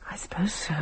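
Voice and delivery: breathy unvoiced whisper; half to herself, barely voiced; whispered, shaken. Unsettled after strange music.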